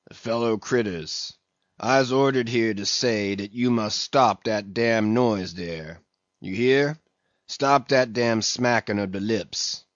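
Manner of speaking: mumbled dialect sermon to the sharks; a sullen old ship's cook preaching to sharks; mumbling old cook. Acted. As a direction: grumble it out, half to himself. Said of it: "in a mumbling voice".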